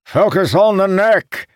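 high, loud battle shout; shouted command. A narrator's reading. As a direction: shout an urgent order to the troops. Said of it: "Calamir shouted".

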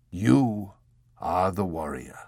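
put-on slow, gentle regret from the old abbot; almost regretful. A narrator's reading. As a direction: explain it slowly, almost sorry. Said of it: "he explained, almost regretfully".

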